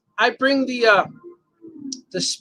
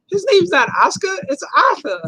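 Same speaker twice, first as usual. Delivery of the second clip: indignant correction; 8 semitones higher than usual. Retold or imitated. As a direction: set her straight, half shouting. Coworker correcting her, mock indignant.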